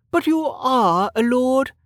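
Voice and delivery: high, slow lady's voice with a smile; high-pitched, pleased noblewoman; smiling noblewoman. Acted. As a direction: insist sweetly in a high aristocratic voice. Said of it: "Lady Parr said, smiling".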